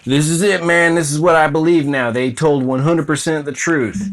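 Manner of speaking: mock news believer. Host mocking a viewer who believes the news, eager and loud. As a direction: play a gullible viewer swallowing the news whole. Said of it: "you're just downloading that into your brain".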